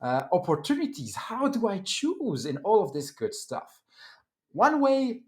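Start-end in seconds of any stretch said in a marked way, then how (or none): 0.0-3.8 mock amazed, higher pitch; excited voice. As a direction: play an overwhelmed listener. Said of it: "And now you might say".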